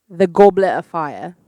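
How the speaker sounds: put-on slow, loud British accent; drawn-out, deliberate British delivery. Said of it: "answer it in a British accent".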